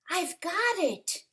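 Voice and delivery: high, bright eureka; sudden discovery, called out; excited cry. A narrator's reading. Snail realizing her plan, excited cry. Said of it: "she cried".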